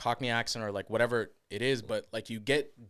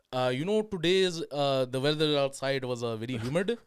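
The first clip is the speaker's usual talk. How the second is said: put-on foreign accent, second-language English; 3 semitones higher, 5 dB louder than usual. Shown as a demonstration. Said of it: "the stereotype to the accent".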